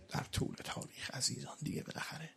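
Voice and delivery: breathy whispered aside. Real, not acted. Preacher confiding to the mourners in a whisper. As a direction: drop to a whisper mid-sermon.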